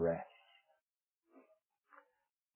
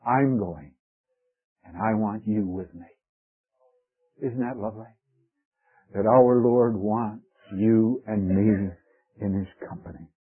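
normal talking voice

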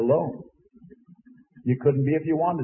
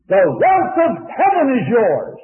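proclaiming loudly; 8 semitones higher, 6 dB louder than usual. Real, not acted. Preacher proclaiming heaven's wealth.

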